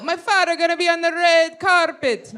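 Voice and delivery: put-on Jamaican patois; hammed-up patois celebrity impression. Shown as a demonstration. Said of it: "I do [name] a lot".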